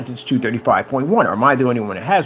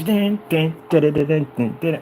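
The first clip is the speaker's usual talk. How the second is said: comic vocal bumping, falling away; rhythmic mock-driving sound effects; bouncing "dun dun" noises; 3 semitones higher than usual. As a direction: imitate a truck careening off road. Acting out crazy driving in film.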